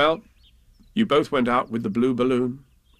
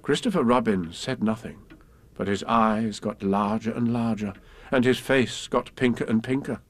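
plain reading voice

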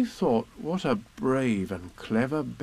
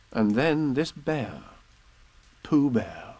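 usual speaking voice

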